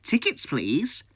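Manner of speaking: put-on high, slow old lady asking for tickets; drawn-out elderly conductor voice; older woman's voice. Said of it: "an older voice just say to me".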